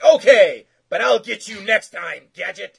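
high, hammed-up cartoon villain; over-the-top cartoon villain sign-off; cartoon villain vow. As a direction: swear revenge like a cartoon bad guy. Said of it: "And he's like".

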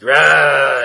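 cartoon monster bellow; monster roar. Monster stomps through the city.

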